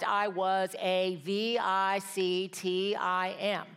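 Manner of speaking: V I C T I M spelled letter by letter, slow; spelled out slowly. Shown as a demonstration. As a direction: spell V I C T I M out letter by letter, each letter separate.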